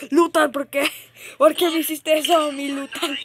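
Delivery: high, loud wounded protest; mock betrayal. Gamer protesting to the friend who killed them, mock betrayed.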